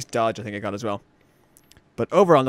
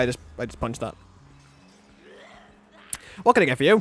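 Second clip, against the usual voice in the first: normal talking voice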